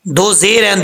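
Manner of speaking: ordinary delivery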